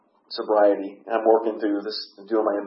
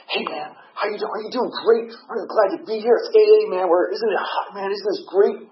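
high-pitched, gushing mock enthusiasm; overcheerful greeter; 11 semitones higher, 4 dB louder than usual. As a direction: imitate a relentlessly chipper guy. Imitates an overcheerful member greeting him.

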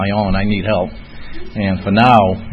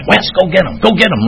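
high, urgent mock yell; imitated yelling; 9 semitones higher than usual. Sponsor sending Wes after the runaway, high mock yelling. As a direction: call it like setting a dog loose. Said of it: "like, yelling at Wes".

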